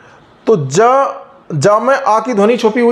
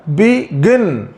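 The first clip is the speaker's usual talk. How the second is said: Begin in two slow parts, weight on गिन; stress on second syllable; 3 semitones lower, much slower than usual. Shown as a demonstration. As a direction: lean on the गिन of begin, drawn out. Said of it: "इसमें दूसरे वाले syllable पर". (Begin में दूसरे syllable गिन पर ज़ोर, धीरे)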